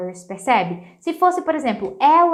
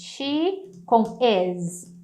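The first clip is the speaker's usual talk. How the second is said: slow; much slower than usual. Shown as a demonstration. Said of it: "O que que é esse she's?".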